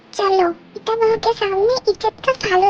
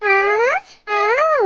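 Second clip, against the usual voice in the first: usual speaking voice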